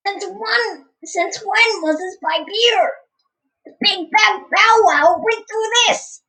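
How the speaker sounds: high squeaky, puzzled character voice; Toad. Acted. Player as Toad finding a new pipe, high squeaky voice. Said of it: "I need a Toad voice".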